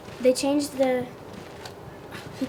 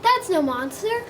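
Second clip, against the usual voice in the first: higher-pitched exclamation; cried out; 6 semitones higher than usual. A narrator's reading. Oscar spotting what other dogs missed, crying out. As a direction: cry it out as a discovery. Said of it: "cried Oscar".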